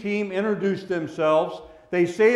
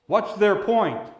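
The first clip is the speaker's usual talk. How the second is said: ordinary talk, nothing marked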